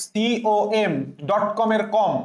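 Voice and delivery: ordinary talk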